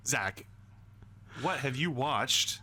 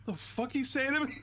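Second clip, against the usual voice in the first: offended retort; 12 semitones higher than usual. Retold or imitated. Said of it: "He's like".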